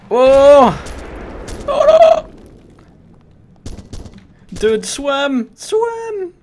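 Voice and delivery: high, loud panicked shrieks; panicking gamer. Real, not acted. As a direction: scream in panic. Panicking as chased.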